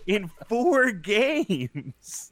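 incredulous. Real, not acted. Incredulous rant at fifty-five.

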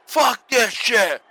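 slow, drawn-out pissed-off yell; mock angry shout. Voicing the pissed-off wrestler, a slow mock shout. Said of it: "Start off pissed off".

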